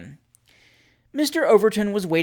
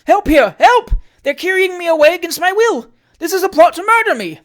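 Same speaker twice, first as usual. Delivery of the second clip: very high, very loud frantic cries; screaming for help; 13 semitones higher, 11 dB louder than usual. Acted. Trott being forced into the chaise, frantic screaming for help. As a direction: scream for rescue.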